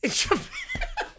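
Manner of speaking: mock voice. Mocking the character's logic.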